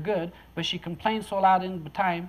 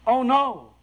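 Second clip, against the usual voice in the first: alarmed cry; 5 semitones higher, 5 dB louder than usual. Retold or imitated. Stopping child drinking bad water.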